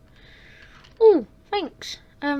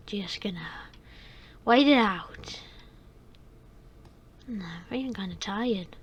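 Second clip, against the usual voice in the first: whispering; 5 semitones lower, 6 dB quieter than usual. Acted. Sleepy whispering in the bathroom.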